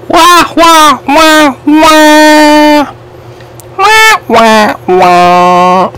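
sing-song fake baby wail; mock crying. Teasing the losing player with a mock crybaby wail. Mocking opponent about to cry.

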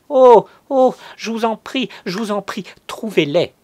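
very high, loud, anguished pleading; cry of suffering. A narrator's reading. Cousin begging for the money, crying out in suffering. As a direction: cry out in pain and beg. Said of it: "Elle poussa une sorte de cri de souffrance".

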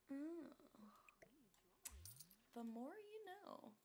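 normal talking voice

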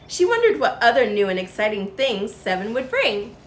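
plain reading voice

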